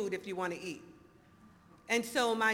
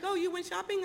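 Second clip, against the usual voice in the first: higher, knowing "Oh, you went shopping?"; sceptical sponsor; 8 semitones higher than usual. Retold or imitated. Sponsor doubting her shopping story, higher pitched and knowing. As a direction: let the doubt ride up. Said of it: "So she's like".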